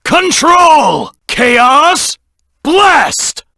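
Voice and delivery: high, loud shout of each attack name; battle cry. Acted. Hedgehog hero shouting his attack names mid-fight, high battle cry. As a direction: yell the move names like unleashing power.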